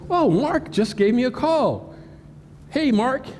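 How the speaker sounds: high, acted delighted recognition; pleased surprise. Victim answers spoofed call from friend, high pleased surprise. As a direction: greet a friend you think is calling.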